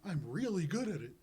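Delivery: high, loud critter voice; eager little creature. Acted. Glub boasts about carrying rocks, in a high, loud creature voice. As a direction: pipe up proudly as a small creature.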